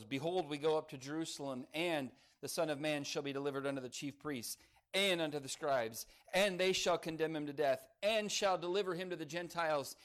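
every "and" leaned on and hit hard; "and" stressed at the start of each clause; emphasis on and. Shown as a demonstration. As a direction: punch each "and" as the list grows. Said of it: "read it again with emphasis on those".

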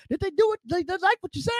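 cackling advice from a comedy legend; Richard Pryor. Retold or imitated. Pryor on the phone advising the young comic, high gleeful voice. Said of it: "Richard Pryor gave me some virtue".

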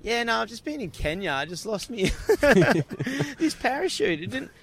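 high, mock-casual schoolkid boast; teasing voice of a returning student; nonchalant kid. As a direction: brag like a cocky teen. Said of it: "you're like".

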